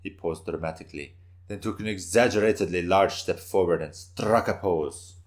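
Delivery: ordinary delivery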